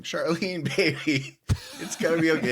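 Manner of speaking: high, hammed-up consoling call; bird calling his love. Flappy searching for Charlene, high comforting call. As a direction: call out sweet nothings through a laugh. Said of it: "start saying".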